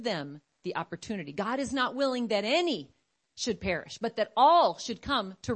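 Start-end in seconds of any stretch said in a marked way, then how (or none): none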